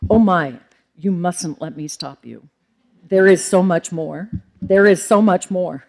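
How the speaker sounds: hammed-up, loud and emphatic exhortation; bad imitation of an encouraging colleague; Robert. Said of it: "This is my bad Robert imitation".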